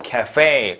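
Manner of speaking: 카페 said as English café, stress on the last syllable; American-style 카페, second syllable stressed; American end stress. Shown as a demonstration. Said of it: "강세가 뒤에 있어요".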